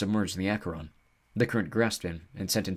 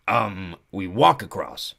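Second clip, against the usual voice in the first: slow centaur voice; 4 dB louder, much slower than usual. Acted. Centaur states the obvious.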